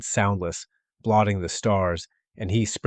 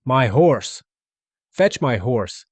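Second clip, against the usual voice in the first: louder, higher calling order; shouting; 4 semitones higher, 6 dB louder, much slower than usual. A narrator's reading. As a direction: shout for the horse.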